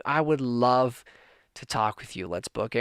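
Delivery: holding back tears as he quotes his friend; choked up. Real, not acted. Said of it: "I'm getting emotional right now".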